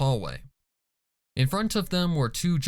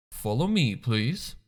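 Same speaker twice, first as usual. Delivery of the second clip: ordinary delivery, nothing marked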